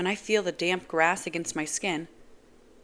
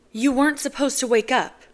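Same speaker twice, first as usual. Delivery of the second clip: higher, louder distressed woman; upset Nazira; 7 semitones higher, 7 dB louder than usual. Acted. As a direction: blurt it out anxiously. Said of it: "she says".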